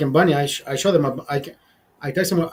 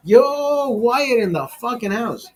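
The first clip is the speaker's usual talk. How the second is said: hyped greeting; 5 semitones higher, slower than usual. Real, not acted. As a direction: hail a newcomer to the stream with hype. Greeting a viewer joining the stream.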